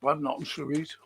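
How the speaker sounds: talking while eating crisps; crunching chips. Real, not acted. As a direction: mouth full of crisps. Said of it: "Sorry, I'm eating crisps".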